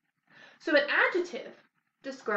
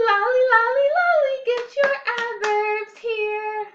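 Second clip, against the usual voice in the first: much higher, louder, sung with a big grin; beaming, high, loud singsong chant; happily; 11 semitones higher, 8 dB louder than usual. Shown as a demonstration. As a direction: sing it out with exaggerated joy. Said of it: "Let's do it happily".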